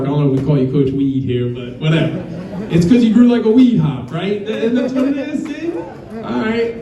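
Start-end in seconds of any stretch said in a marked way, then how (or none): 2.7-6.8 laughing. Real, not acted. Joking about the nickname Weed.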